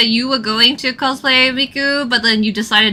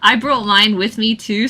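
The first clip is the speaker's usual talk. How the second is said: normal talking voice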